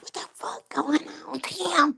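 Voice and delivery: whispered mock mom; hiss-free hushed whisper of a worried mother. Mom whispering to Tim about the men, hushed. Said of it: "His mama was like".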